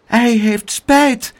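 high, slow hesitant plea; stammering. A narrator's reading. Aunt defending the boy to angry neighbours, high stammering. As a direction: falter through an anxious defence. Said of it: "stamelde tante Pleeg".

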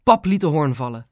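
plain reading voice

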